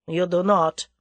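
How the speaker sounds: grudging, begrudged concession; sullen. A narrator's reading. Boris concedes the point, sullen. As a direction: give in sullenly. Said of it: "admitted Boris at last sullenly".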